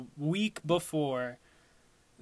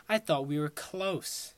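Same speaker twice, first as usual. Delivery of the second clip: ordinary delivery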